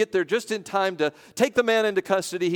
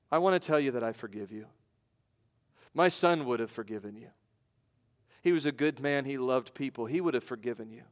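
retold softly in a lower voice; understated, gentle forgiveness in short phrases; quiet, calm; 4 semitones lower, 6 dB quieter than usual. Victim's father forgiving his son's killer, quietly and calmly. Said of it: "very, very quietly, very calmly".

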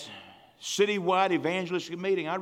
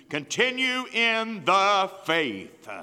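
very slow and loud; drawn-out phrase; 4 semitones higher, 5 dB louder, much slower than usual. Real, not acted. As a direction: stretch it out. Stretched scripture ending.

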